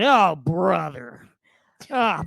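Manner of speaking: slow, drawn-out mock groan of "Oh, brother", rising loud on the last "Oh"; imitating a winded man on a treadmill, slow and pained; treadmill guy groan. Imitating the gym guy on a runaway treadmill, slow, loud and groaning. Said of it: "he keeps saying".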